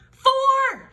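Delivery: high, loud shouted answer. A narrator's reading. Camilla shouting the answer in class, high and loud. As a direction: blurt it out without waiting. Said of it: "shouted Camilla Capybara".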